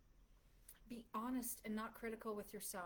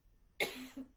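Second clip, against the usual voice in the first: cough; 3 semitones higher, 5 dB louder than usual. Real, not acted. Cough mid talk.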